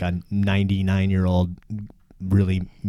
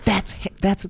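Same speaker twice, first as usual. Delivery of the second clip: high, slow dismayed mom; mother realizing the disaster; horrified mom; 13 semitones higher, slower than usual. Retold or imitated. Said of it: "And she's like".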